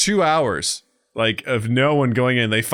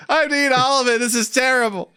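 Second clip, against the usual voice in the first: high-pitched mock despair; hammed-up suffering; anguished egg eater; 10 semitones higher than usual. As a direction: whine it in exaggerated misery. Acts out forced egg eater.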